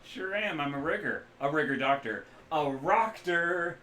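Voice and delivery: loud, high, boisterous mechanic-doctor voice; hungover rigger. Acted. The rigger doctor jokes to the runners, loud and jolly.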